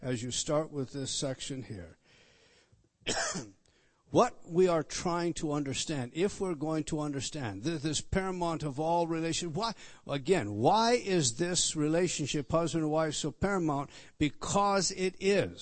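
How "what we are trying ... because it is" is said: louder, higher emphatic sermon voice; fervent preaching. Real, not acted. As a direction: build the question, land the answer. Emphatic rhetorical question and answer.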